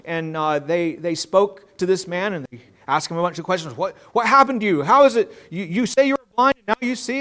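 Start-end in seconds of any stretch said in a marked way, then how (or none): 3.8-7.2 a hint of suspicious leaders, pitched up; mock interrogation. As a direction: leaders grilling the healed man, mildly acted. Leaders question the healed man.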